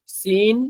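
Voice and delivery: সিন light and high, like a sparrow's chirp; সিন, a light sparrow-like hiss; light chirpy sin. Shown as a demonstration. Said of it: "চড়ুই পাখির মতো আওয়াজ হবে হালকা".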